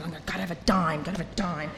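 soft, low anxious mutter; muttering to herself. Retold or imitated. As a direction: repeat it under your breath. Newcomer repeating sponsor's orders.

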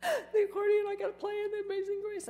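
high, crying wail through tears; retold sobbing. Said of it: "I'm sobbing".

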